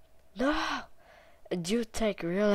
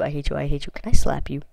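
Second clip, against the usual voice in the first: ordinary talk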